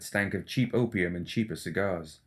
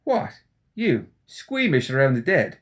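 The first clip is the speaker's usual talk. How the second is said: much higher, louder mock astonishment; mock surprise; 5 semitones higher, 5 dB louder, slower than usual. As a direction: feign shock to tease him. Seamus mocking Nicodem's unease.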